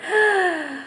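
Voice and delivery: a big pretend gasp of wonder; hammed-up classroom gasp; mock surprised gasp. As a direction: gasp as if amazed by the card. Reveal of duck card.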